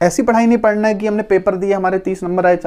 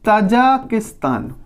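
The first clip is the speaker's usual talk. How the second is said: Tajikistan said very slowly; slow drawn-out name; much slower than usual. Shown as a demonstration. Teacher to pupils, slowly naming a country on the map. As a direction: draw out Tajikistan for the class. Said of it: "Kyrgyzstan के नीचे है".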